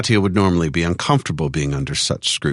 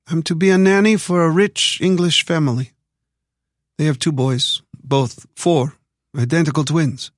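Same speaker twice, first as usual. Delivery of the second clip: lightly voiced young heroine, pitched higher; a hint of a hopeful newcomer explaining her job; heroine's voice; 11 semitones higher than usual. Katya tells the stranger her nanny job, higher lightly voiced heroine.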